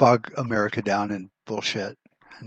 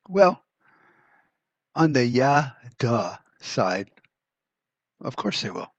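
sarcastic well duh; 2 semitones higher, slower than usual. Real, not acted. Podcaster scoffing at a survival video's obvious point, sarcastic. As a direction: scoff at something too obvious to say.

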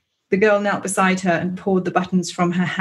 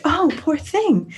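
put-on high, slow American coo over a crying baby; lightly American, soothing the upset baby; American accent; 9 semitones higher, slower than usual. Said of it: "I like how you do the American accents".

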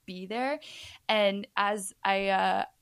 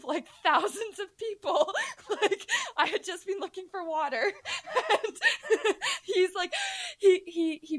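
words breaking into laughter, high; laughing; 10 semitones higher than usual. Real, not acted. Telling the host about the stage, laughing. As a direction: giggle through the line.

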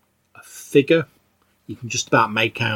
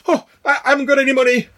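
high, loud panicked cry; high-pitched fright; frightened old woman; 5 dB louder, much faster than usual. Acted. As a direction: plead in alarm as a startled old woman. Startled old woman fears robbery.

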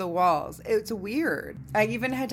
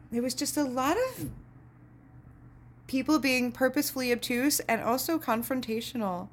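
ordinary talk, nothing marked

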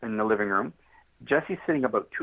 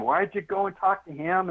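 retold angry wife; 8 semitones higher than usual. Wife quarrelling with husband Greg.

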